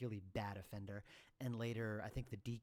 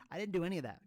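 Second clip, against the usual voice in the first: ordinary talk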